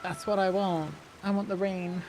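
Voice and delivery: ordinary talk, nothing marked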